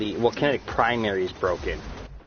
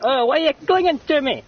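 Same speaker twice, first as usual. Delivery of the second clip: high, louder whiny voice; mock whiny victim; 6 dB louder than usual. Streamer playing the victim of the Klingon, whiny. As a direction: whine it like a clingy victim. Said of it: "you're all like".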